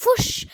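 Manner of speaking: sudden loud, high whoosh; punchy spoken sound effect. A narrator's reading. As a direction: burst out with a swooping noise. Narrator voices the sudden swerve.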